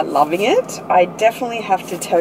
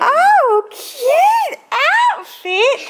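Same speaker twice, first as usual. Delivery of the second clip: delighted cooing; 4 dB louder, much slower than usual. Real, not acted. Mum admiring her child's outfit, high delighted cooing. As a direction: coo it way up high.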